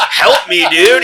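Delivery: loud, high mock plea; retold cry for help. Robbery victim pleading with a passing driver, loud mock desperation. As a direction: call out as if flagging down help.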